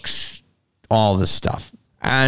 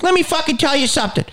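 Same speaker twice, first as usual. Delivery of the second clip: much higher, fast, ranting comic; Bill Burr; much faster than usual. Retold or imitated. Imitating a comic who owns the room, fast and high. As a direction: fire it off like a confident ranting comic. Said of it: "when you watch Bill Burr, he's like".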